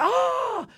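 high, drawn-out "Ah!" at normal volume; held high mock scream; mock crowd scream. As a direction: imitate a crowd's scream on one long high note. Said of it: "everyone was screaming".

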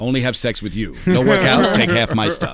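lightly voiced grunting caveman; clipped caveman talk, half-joking; caveman impression. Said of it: "my impression of a caveman".

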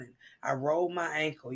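normal talking voice

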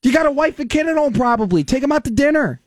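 very high, disbelieving outburst; incredulous rant. Real, not acted. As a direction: squeal with disbelief.

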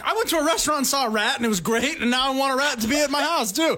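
high gushing impersonation; mock excited voice. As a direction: gush like an excited friend. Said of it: "And you're like".

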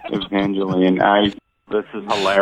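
low, laughing through it; amused disbelief, laughing. Real, not acted. Reacts to prank reveal.

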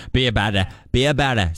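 put-on Boston accent, dropping the r's. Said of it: "That's my Boston accent".

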